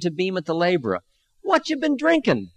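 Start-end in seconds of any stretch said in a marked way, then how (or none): 1.4-2.5 put-on much higher, curious elderly voice; an old man chatting up an angry drunk; interested old man. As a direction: ask it with a twinkle of real interest. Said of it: "his eyes sparkling with interest".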